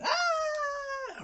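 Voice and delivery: high shrieking mock scream of fright; hammed-up terrified shriek; retold scream. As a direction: shriek like a startled giant. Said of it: "starts screaming".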